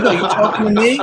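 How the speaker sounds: put-on a giggling boy insisting on the movie line; boy pushing the bit, laughing; kid's tough-guy line. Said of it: "He's like".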